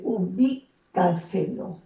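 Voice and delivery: "ubícaselo" slow and loud, weight on bí; stress on BI. Shown as a demonstration. Teacher showing where the voice falls hardest, BÍ stressed in "ubícaselo". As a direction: draw out "ubícaselo", leaning on the syllable bí. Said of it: "Dónde lleva la mayor fuerza de voz".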